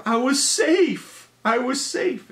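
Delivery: put-on boy protesting to the pitcher he was safe; indignant protest. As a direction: insist you were safe. Kid protesting kickball call.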